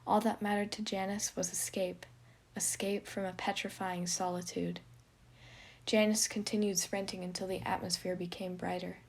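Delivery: usual speaking voice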